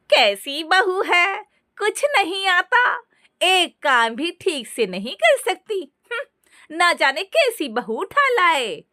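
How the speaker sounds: high, slow, scornful gossip; gossiping relatives. A narrator's reading. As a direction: sneer at the clumsy daughter-in-law. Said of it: "तरह तरह की बातें करने लगे".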